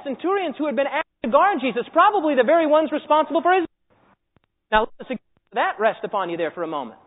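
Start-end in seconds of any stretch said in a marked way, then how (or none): none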